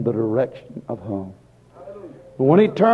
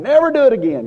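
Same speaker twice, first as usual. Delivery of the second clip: much higher and louder on NEVER; fervent cry; 10 semitones higher, 5 dB louder, faster than usual. Retold or imitated. Repentant sinner crying his vow to God, fervent and loud.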